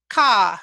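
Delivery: a short loud caw like a crow. Shown as a demonstration. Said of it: "I'm actually gonna make a noise".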